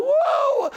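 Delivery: very high, falling "whoa"; re-enacted delighted whoop. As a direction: whoop at the sight of the banknote. Re-enacts joy at the money.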